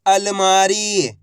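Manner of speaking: अलमारी very slow, long final ee; syllables blended slowly. Shown as a demonstration. Teacher joining letters for learners, अलमारी drawn out. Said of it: "तो मिलके बन जाएगा".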